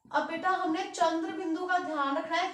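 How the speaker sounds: usual speaking voice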